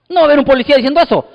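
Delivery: normal talking voice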